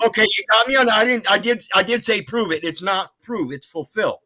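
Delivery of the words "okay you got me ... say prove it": flustered. Real, not acted. Caller flustered, corrected.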